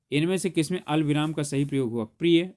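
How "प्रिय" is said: प्रिय louder, then stop; pause after प्रिय. Shown as a demonstration. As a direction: break off right after प्रिय. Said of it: "नहीं".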